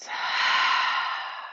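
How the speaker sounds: soft breathy sigh out; demonstration sigh. Shown as a demonstration. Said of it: "And a big sigh out".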